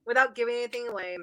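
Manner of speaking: ordinary talk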